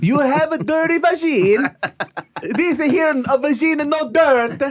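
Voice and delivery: very high, cartoonish Italian accent; laughing, high-pitched Italian caricature. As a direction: squeal it in mock Italian.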